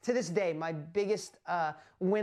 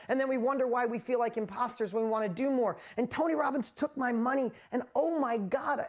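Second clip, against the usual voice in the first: normal talking voice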